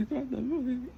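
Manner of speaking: high acted tearful squeak; re-enacted weepy whimper; crying. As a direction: squeeze it out through put-on sobs.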